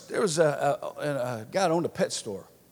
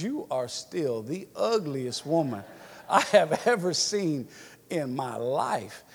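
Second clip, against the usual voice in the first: rude parrot; slower than usual. Acted. Parrot insults the returning lady, slow and deliberate. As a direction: stretch it out phrase by phrase to the punchline.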